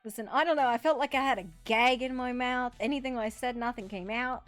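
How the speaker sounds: ordinary talk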